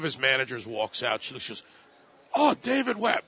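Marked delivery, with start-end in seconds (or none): 2.3-3.2 imitating a heavy Chinese accent, pitched high. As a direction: quote her in a thick Chinese accent. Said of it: "with a heavy Chinese accent".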